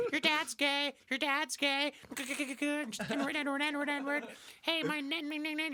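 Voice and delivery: put-on high, chanting kid voice; mock a little kid chanting taunts; squeaker taunt. Kid chanting taunts at a beaten player, high. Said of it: "He just destroys you".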